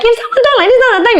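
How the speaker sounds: very high, quick squeaky demo voice; high-pitched voice. Shown as a demonstration. Voice artist showing an unpleasant voice, exaggeratedly high. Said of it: "the lower voice".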